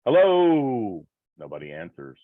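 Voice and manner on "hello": raised, higher call into the dark room. Pretend. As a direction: call out into the empty darkness, questioning. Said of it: "So I call out".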